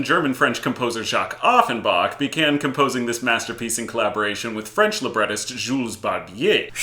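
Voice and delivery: ordinary talk, nothing marked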